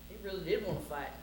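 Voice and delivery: plain reading voice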